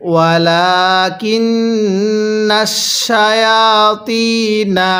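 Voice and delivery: slow chanted ওয়ালাকিন্নাশ with a long humming "nn"; ghunnah recitation. Shown as a demonstration. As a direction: hold the nun in the nose, level and slow. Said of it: "এই নুনের মধ্যে আমরা গুনাহ করব". (নুনে গুন্নাহ করে ধীরে তিলাওয়াত)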